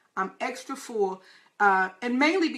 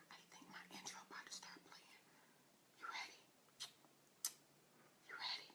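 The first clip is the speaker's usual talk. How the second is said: hushed whisper before going live; 31 dB quieter, faster than usual. Real, not acted. Host whispering to listeners just before the show starts. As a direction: whisper it so the mic barely catches it.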